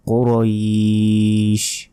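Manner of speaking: কুরাইশ drawn out slowly on its last vowel; thick q, then a long "oiiish" in কুরাইশ; stretched ending. Shown as a demonstration. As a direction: recite কুরাইশ, holding the end. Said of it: "শেষে টান হবে".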